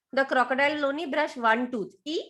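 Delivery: ordinary delivery, nothing marked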